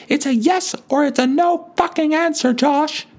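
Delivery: very high, shrill-pitched furious granny tirade; cat lady screaming at the grocery boy; screeching old lady rant. Retold or imitated. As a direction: shriek it like a sweet old lady gone feral. Sweet old lady erupts in tirade.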